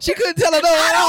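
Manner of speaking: high, loud insistence; emphatic excitement. Real, not acted. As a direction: hammer the point home. Emphatic laughing repeat.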